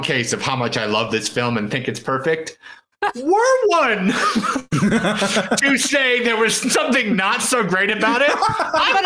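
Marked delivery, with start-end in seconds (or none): 3.1-9.1 high laughing, can't hold it together. Real, not acted. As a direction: giggle through a mock-formal line. Laughing through mock formal critique.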